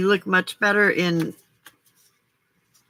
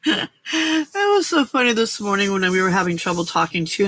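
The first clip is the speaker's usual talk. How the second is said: ordinary talk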